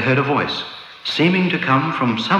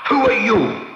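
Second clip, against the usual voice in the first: crying out; 12 semitones higher than usual. A narrator's reading. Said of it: "rushed toward the little man and cried out".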